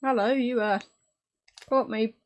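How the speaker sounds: talking with a mouth full of chocolate. Real, not acted. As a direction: mouth full, mumbled greeting. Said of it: "I had my mouth full".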